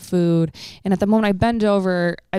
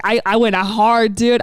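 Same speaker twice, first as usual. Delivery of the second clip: normal talking voice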